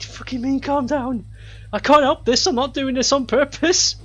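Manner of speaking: high, mock-indignant protest; indignant retort. Sick student answering the stranger, mock indignant. Acted indignant reply in retold story.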